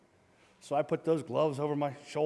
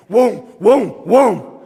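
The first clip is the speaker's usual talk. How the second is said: three loud, rising-pitched whooms; imitated punch whooshes; 4 semitones higher, 14 dB louder, much slower than usual. Opponent charges in swinging, loud imitated whooshes. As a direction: voice big swings flying past.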